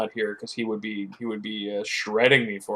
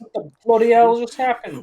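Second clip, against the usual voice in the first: high, loud mock Cockney exclamation; over-the-top Cockney shout; 11 semitones higher, 5 dB louder than usual. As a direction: belt it out in a hammed-up Cockney accent. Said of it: "she's supposed to be Cockney".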